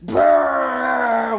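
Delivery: loud buzzing "brrr" of a rattling car part; noisy trilled rumble; imitated car noise. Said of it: "make a f-, not fun noise".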